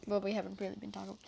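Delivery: fast muttered aside under the breath; quick aside. Real, not acted. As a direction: toss it off quickly and quietly. Quick quiet aside correcting.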